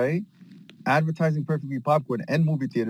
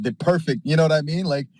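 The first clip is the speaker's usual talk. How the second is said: ordinary delivery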